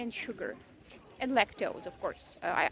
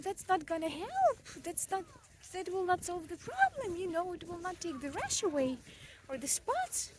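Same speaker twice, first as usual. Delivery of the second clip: higher-pitched, plaintive retort; upset protest; 6 semitones higher than usual. Retold or imitated. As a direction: retell a distressed objection, voice lifted. Retold upset protest to the doctor.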